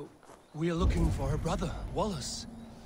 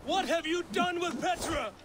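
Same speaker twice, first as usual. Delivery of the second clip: berserk rage; 12 semitones higher than usual. Acted. Eivor demands answer, enraged.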